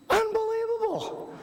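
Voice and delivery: high, slow, marvelling; amazed. Real, not acted. Speaker marvels at the volunteer's idea, amazed high voice. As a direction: let the wonder lift it high.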